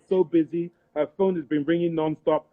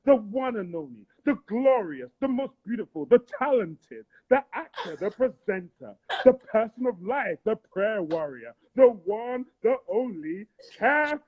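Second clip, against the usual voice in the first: high, booming announcer build-up; hype man intro; 4 semitones higher than usual. Pretend. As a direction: hype her up like a stage MC. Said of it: "I need you to be my hype man".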